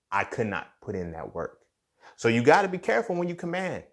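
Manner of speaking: ordinary talk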